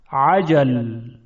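عجل opened with a squeezed throat ع, slow; ayn pronunciation. Shown as a demonstration. Teacher contrasts hamza with ayn. (تلفظ عجل با عین حلقی)

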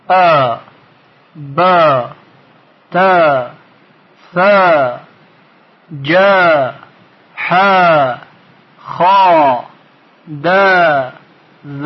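each letter held with long aa: baa, taa, jaa; long aa syllables. Shown as a demonstration. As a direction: stretch "ج" to jaaa, one syllable at a time. (تلفظ حروف با آی کشیده)

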